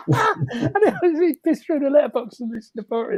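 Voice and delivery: real amused laugh. Real, not acted. Laughing at the story.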